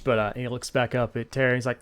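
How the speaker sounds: ordinary talk, nothing marked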